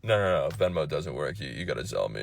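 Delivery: low, soft, indifferent dude voice; mumbled low-pitched seller impression; unhelpful dude. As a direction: play a guy who can't be bothered. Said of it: "he would've just been like".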